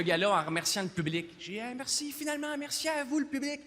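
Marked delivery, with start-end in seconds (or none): 1.6-3.6 tag imitation. Imitates his usual closing thanks.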